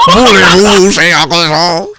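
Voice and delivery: mock French accent. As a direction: flirt in a ridiculous fake French accent. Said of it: "catch the accent".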